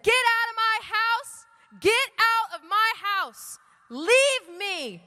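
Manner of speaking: loud, high, drawn-out yell; scream at the devil. Retold or imitated. Woman with her voice back banishing the devil, screaming. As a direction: order the intruder out at full force. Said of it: "I screamed at the devil".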